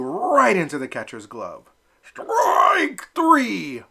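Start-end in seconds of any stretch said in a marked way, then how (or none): 2.0-3.8 loud, high umpire shout; umpire's cry. Acted. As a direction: call it out loud. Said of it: "cried the umpire".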